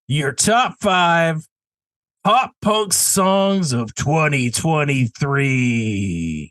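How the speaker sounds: very slow, drawn-out announcer reveal. Pretend. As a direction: stretch every word like an announcer unveiling a category. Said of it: "a deep announcer voice".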